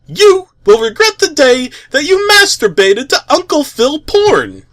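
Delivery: menacing threat. A narrator's reading. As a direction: read the evil note as a raving villain. Threatening message read out.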